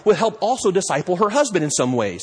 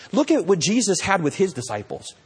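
ordinary talk

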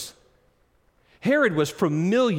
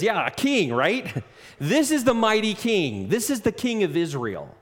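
higher, louder sarcastic jeer with a laugh; mocking; 7 semitones higher, 3 dB louder than usual. Soldiers mocking Jesus in the purple robe, sarcastic. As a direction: sneer at him, laughing him down. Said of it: "and they mocked him".

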